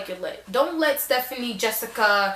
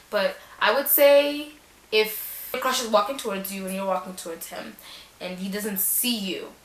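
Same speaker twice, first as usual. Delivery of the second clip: ordinary talk, nothing marked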